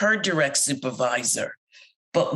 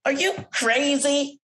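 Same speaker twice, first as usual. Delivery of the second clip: disbelief; 9 semitones higher than usual. Real, not acted. Incredulous reaction to coworker.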